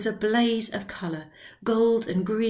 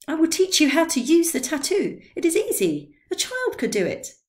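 put-on high, loud coaxing old man; lightly hammed-up tipsy enthusiasm; excited Drioli; 8 semitones higher, 6 dB louder than usual. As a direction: talk him into it with glee.

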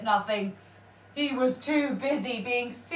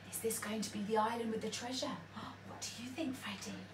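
hushed whisper; 12 dB quieter, much faster than usual. Real, not acted. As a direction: lean in and whisper the question. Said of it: "whisper to child".

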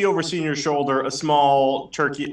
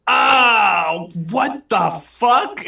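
high loud yelp, then slow outrage; pained cry; 7 semitones higher, 4 dB louder, much slower than usual. Acted. Senior hit by a boy, crying out loud. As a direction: cry out as the bat hits.

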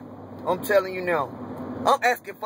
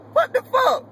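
high, sharp incredulous exclamation; outraged disbelief; 13 semitones higher, 3 dB louder than usual. Real, not acted. Fan imagining a free agent's reaction: high, incredulous outburst. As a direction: leap up in pitch in shocked outrage. Outraged at cutting pass rusher.

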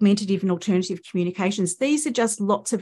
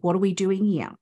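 ordinary talk

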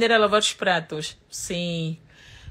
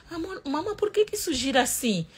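imitating her little son; 10 semitones higher than usual. Mother imitates son asking question.

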